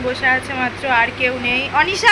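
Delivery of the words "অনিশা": calling out. Real, not acted. Calling the child.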